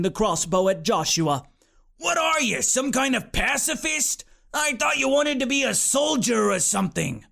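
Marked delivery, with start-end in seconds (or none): 2.0-7.2 mocking demon. A narrator's reading. Demon mocks the boy.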